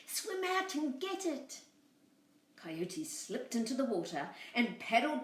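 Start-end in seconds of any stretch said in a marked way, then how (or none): 0.0-1.6 high urging lamb voice. Acted. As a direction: urge him on, high.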